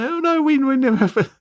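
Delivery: very high-pitched mock protest; hammed-up high little voice; mock complaint. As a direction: squeak it out like a flustered complainer. Mocking Atlantis people's imagined complaint.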